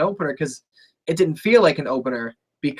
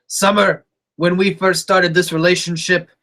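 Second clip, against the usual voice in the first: loud, emphatic mock declaration; put-on wrestler's proclamation, louder toward the end; Rusev impression; 2 semitones higher, 4 dB louder than usual. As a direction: deliver it as a big, raised-voice quote. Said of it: "the best Rusev line of all time".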